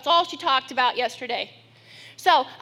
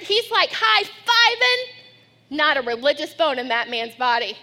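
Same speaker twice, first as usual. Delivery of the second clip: normal talking voice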